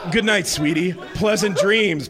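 mocking sweet goodnight; mocking purr. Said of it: "somewhat mockingly".

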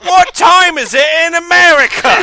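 a wobbly fake London accent asking about time; West London accent. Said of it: "What accent was that?".

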